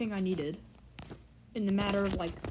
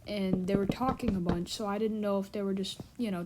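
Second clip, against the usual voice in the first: ordinary delivery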